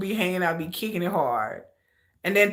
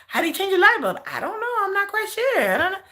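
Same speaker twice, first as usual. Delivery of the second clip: higher, dithering, confused voice; mocking clueless men. Mocking American men who can't change bulbs, higher dithering voice. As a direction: play a helpless man who can't change a bulb. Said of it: "Men here be like".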